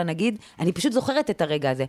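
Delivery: normal talking voice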